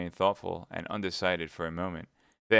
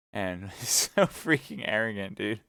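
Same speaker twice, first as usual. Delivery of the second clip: laughing aside; 3 semitones higher, 6 dB louder than usual. Real, not acted. Reader laughs at the character.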